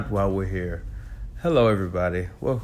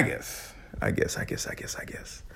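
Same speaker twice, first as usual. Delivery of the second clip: whispered to himself, fading out; whispering; 10 dB quieter than usual. Real, not acted. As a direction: mutter it under your breath in a whisper. Trailing off after laughing.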